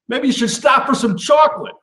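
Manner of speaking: catchphrase. Acted. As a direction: toss out the refrain like a jingle. Recurring catchphrase refrain.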